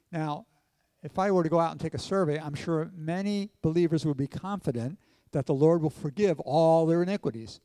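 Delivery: ordinary talk, nothing marked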